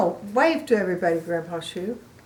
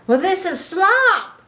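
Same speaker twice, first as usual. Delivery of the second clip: high, louder piggy voice; proud piggy voice; 10 semitones higher, 5 dB louder than usual. Acted. Grandma voicing Piggy proudly showing her slop. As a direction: squeal it out proudly like a happy pig.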